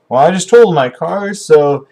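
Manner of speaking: mock resigned young driver; wry customer reply. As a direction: toss it off like bad news you can't fix. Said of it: "And the guy responds".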